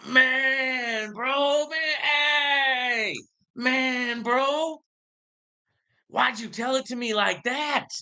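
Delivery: high, wailing fake cry; hammed-up sobbing friend; mock wailing. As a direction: whine it like a heartbroken guy. Crying friend in cat joke.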